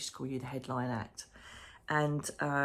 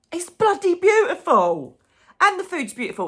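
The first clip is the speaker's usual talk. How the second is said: high, loud gushing rave; squealing with delight over the hotel; 12 semitones higher, 11 dB louder than usual. Real, not acted. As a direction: rave about it, thrilled.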